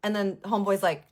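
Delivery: usual speaking voice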